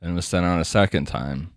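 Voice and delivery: ordinary delivery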